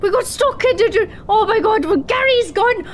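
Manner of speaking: high, loud, frantic apology turning to outrage; screeching husband. Acted. Husband screeching back at his wife over the lost Gary. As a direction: squeal it back at her in a panic. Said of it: "He's like".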